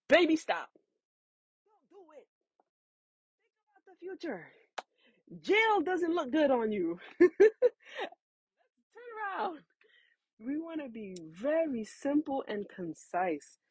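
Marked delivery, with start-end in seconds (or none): 0.1-9.6 lightly comic pleading, higher; half-laughing plea to stop; pleading mock calm. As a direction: talk them down like a hostage negotiator. Talking down a mad person.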